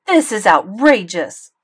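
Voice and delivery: mother interrupting the doctor, steaming; steaming anger. A narrator's reading. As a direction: seethe it out loud.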